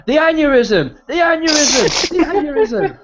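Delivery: high, loud commentator's cry; imitated ringside commentary yell; Michael Cole shouting. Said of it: "Michael Cole would always shout".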